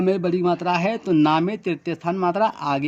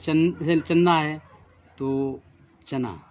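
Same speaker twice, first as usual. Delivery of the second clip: ordinary talk, nothing marked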